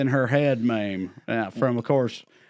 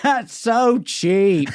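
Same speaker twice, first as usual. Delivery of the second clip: put-on laughing kid voice; 6 semitones higher, 3 dB louder, much slower than usual. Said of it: "and then he goes".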